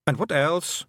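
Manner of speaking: put-on high, lilting Scots-accented little man; a cheeky tiny clansman owning up; wee Scottish Feegle. Feegle chief confessing to the young witch, high Scots voice.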